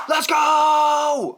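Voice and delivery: loud high cheer; fan shout. Retold or imitated. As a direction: yell it like a fan at a game. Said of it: "yo, I'm".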